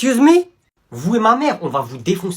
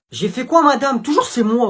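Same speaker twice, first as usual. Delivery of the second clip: 1 cheeky pupil whine; 8 semitones higher, 5 dB louder than usual. Acted. Pupil protesting to teacher.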